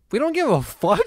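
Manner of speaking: high-pitched mock schoolkid heckle; heckling kid. Kid in the back heckling the teacher, high mock voice. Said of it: "the kid in the back goes".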